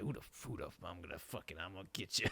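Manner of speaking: low, soft, menacing mumble, half-joking; mock muttered threat. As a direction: growl it under your breath like a confused tough guy. Friend's dog-eyed look voiced.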